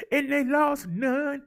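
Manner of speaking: slow, high sing-song on "lost none"; punching lost. Acted. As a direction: hit "lost" high and hold it. Big stat called out with emphasis.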